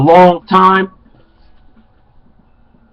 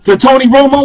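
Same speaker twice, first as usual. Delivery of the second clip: heated loud; 9 semitones higher, 4 dB louder than usual. Real, not acted. Ranting about Dez's sideline outburst.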